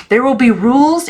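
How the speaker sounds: high, loud laying down rules; stern aunt. A narrator's reading. Aunt Lillian announcing rules, high and stern. Aunt voiced in read story.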